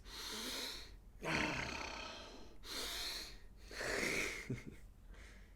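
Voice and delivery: big, audible sighing breaths; exaggerated breathing. Shown as a demonstration. Meditation teacher showing listeners how not to breathe, exaggerated breathing. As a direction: heave the breath in and out noisily. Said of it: "I'm not doing this".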